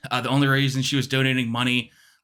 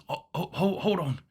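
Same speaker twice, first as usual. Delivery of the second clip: put-on softer, higher, stammering British old man; Britishy accent; 4 semitones higher, 5 dB quieter than usual. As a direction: break off as a flustered British caller. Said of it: "Britishy accent".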